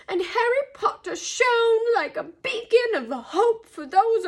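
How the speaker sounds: high, pleading elf voice with fervent swells; earnest house-elf. A narrator's reading. Dobby warning Harry about Hogwarts, earnest and high. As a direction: speak high as a reverent, worried little elf.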